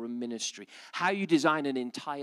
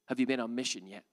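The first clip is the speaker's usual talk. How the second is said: normal talking voice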